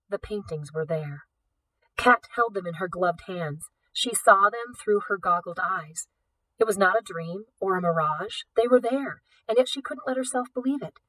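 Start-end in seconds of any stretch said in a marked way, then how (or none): none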